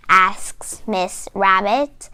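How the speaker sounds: usual speaking voice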